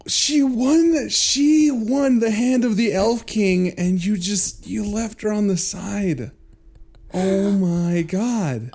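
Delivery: high, loud, slow outraged disbelief; mock outrage. Real, not acted. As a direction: play up the shock.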